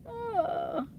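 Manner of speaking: high laughing oh; laughing cry. Real, not acted. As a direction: squeal it mid-laugh. Reacting to a bad word.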